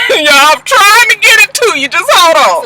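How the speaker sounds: high, strained plea to the viewers; exasperated squeal. Real, not acted. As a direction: laugh and squeal it out, flustered. Fussing over the dark picture.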